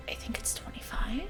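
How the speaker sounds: unvoiced whispered guess; whispering. Real, not acted. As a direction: whisper it to yourself.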